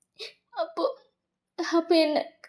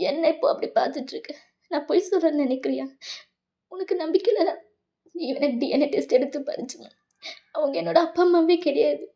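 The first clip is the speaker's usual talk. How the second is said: ordinary talk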